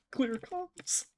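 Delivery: laughing whisper. Real, not acted. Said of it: "All right, so clear comms".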